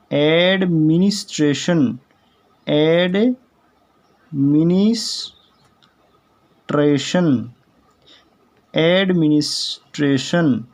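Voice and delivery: Administration drawn out slowly, syllable by syllable; slow model pronunciation. Shown as a demonstration. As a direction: stretch out administration for learners. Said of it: "इसके बाद है next word".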